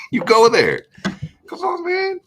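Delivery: very high squeal of disbelief; mock exasperation. Telling viewers to just get on YouTube, squeaky mock exasperation. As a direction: plead in a squeaky, laughing falsetto.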